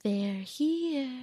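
put-on drawn-out, soft, eerie little-girl line; hushed, sing-song horror-film child; creepy little girl. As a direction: softly chant it like a movie child. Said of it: "She's the little girl".

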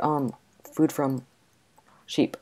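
normal talking voice

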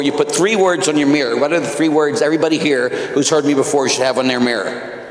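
ordinary talk

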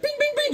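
very high bing bing bing; sing-song chime. Retold or imitated. Walking in and announcing himself, high sing-song chime. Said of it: "I was like".

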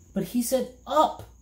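put-on boy's high complaining voice; bitter complaint. A narrator's reading. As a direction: whine it like a cheated kid. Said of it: "Milo complained bitterly".

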